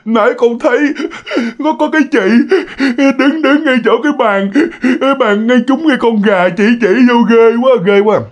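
put-on high, halting child voice with sobs; imitated frightened child struggling to explain; crying toddler. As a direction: stammer it like a sobbing toddler. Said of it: "Nói".

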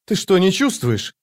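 put-on high-pitched, urgent girl's voice; high, incredulous girl's question; alarmed question. A narrator's reading. Alarmed girl questions Paran.

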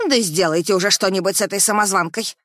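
loud shrill demand; angry shouting. Acted. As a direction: yell it at the guards. Mother joins the harassment, shouting.

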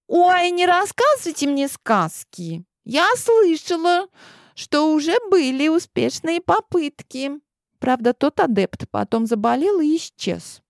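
put-on very high, teasing girl voice; coy princess voice. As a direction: tease them like a spoiled girl. Clementine teasing the boys.

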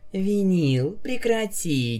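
giggly sleepy protest; sleep talking. Acted. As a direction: giggle it without waking. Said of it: "не просыпаясь, хихикала Октавия".

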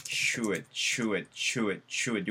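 should said as "shoet", rounded with a t ending; shoet sound. Shown as a demonstration. Teacher drilling should as shoet, repeated softly. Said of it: "should가 아니고 뭐?".

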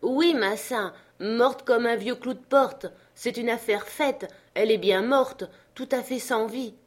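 high, loud, insistent answer; emphatic servant up a tree. Acted. Jupiter confirms to his master the branch is dead, emphatic. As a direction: call down with total certainty.

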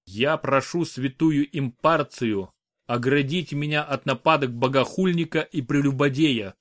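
plain reading voice

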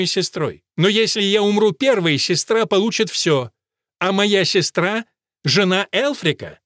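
each word clear and separate; measured, distinct, unhurried; slow and deliberate. Acted. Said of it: "заговорила чётко и раздельно".

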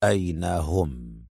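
low, soft, slow demand; cold hard question; icy stern whisper-like demand; dry and stern. A narrator's reading. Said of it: "خرج صوت أدهم من بين شفتيه جافا صارما".

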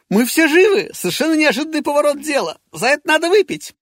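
high, loud, fast elation; jubilant announcement. Acted. Petrov announcing to everyone they're alive, high and loud. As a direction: celebrate at full voice.

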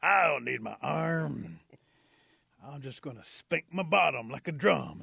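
loud, slow, drawn-out indignation; hammed-up indignant rabbit; defiant rabbit. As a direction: stretch each word out loudly in stubborn defiance. Defiant rabbit lost his arm.

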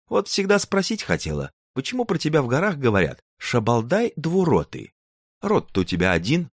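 put-on a sly girl poking fun; high young woman voice. Tatyana teases giant.

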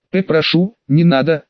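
ordinary delivery

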